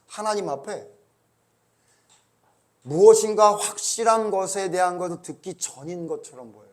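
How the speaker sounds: ordinary delivery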